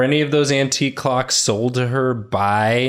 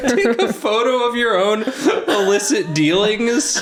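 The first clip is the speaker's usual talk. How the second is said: high, slow laughing jab; mock him through laughter; laughing mockery; 9 semitones higher, slower than usual. Real, not acted. Mocking the suspect, laughing.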